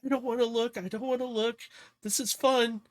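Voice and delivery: high, nervous little character voice; acted jittery high-pitched voice; frightened passenger. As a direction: squeak it out while hanging on for dear life. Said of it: "she's just like".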